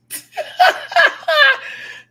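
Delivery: real high-pitched cackle of amusement; shrill giggle fit; high shrieking laugh. Real, not acted. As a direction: burst into a squealing laugh. Laughs after listing the scale.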